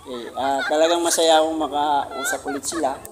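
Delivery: ordinary talk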